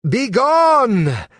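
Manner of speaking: loud, high cry of banishment; commanding cry. Acted. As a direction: hurl the word out like a spell. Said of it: "she cried".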